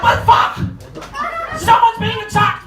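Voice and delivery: panicked yell, high and loud; alarmed shout. Retold or imitated. Woken at night by screams, panicked shout. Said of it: "You're like".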